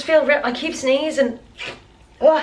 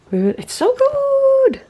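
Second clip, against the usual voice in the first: delighted squeal; 4 dB louder, slower than usual. Real, not acted. Delight over coffee foam.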